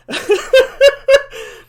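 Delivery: loud, high-pitched burst of laughter; real laughter. Real, not acted. Explaining the tea-slurping noise to listeners, loud real laugh. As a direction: break into a big high laugh.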